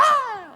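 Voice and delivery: very high shriek of a starstruck fan; imitated fan shriek. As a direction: squeal like you just glimpsed a star. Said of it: "he'd go".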